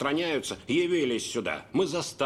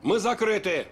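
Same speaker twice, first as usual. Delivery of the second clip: angry; 6 semitones higher, 4 dB louder than usual. Acted. Owner throws him out.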